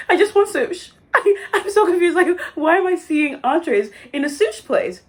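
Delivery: laughing in disbelief at the menu. Real, not acted. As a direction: giggle out the complaint. Laughing at herself.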